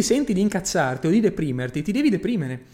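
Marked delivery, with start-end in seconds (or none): none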